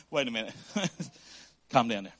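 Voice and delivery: mock calming down. Pastor cools his own mock outburst, low and soft with a laugh. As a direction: pull yourself back down, chuckling.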